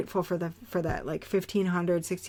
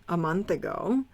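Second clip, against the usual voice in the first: normal talking voice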